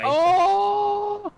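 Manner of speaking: sudden high yelp of joy; excited shout. Real, not acted. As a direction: cry out at the lucky roll. Nat twenty roll reaction.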